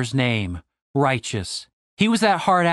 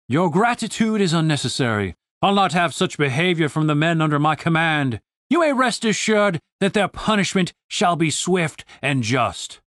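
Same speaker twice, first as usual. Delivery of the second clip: formal, pompous military officer; stately officer's pronouncement; stern commander. Acted. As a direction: assure her with solemn authority.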